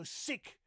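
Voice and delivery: "sick" with an S for the TH; loud, hissed-S "sick" in place of thick. Shown as a demonstration. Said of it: "not sick, but thick".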